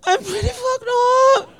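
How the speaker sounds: put-on high, whiny drunken wail; hammed-up tipsy cry; mock drunk whine. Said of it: "and I'm like".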